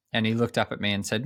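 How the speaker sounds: ordinary delivery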